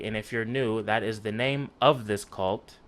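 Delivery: ordinary talk, nothing marked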